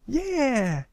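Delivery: high, loud excited cheer; excited yeah. A narrator's reading. Apple Bloom agreeing with her friend, thrilled. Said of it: "with equal excitement".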